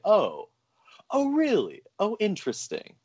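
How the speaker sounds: high, rising-then-falling mock surprise; intrigued realisation. Listener acting out recognising a familiar actor's voice, intrigued. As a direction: act out sudden, intrigued recognition.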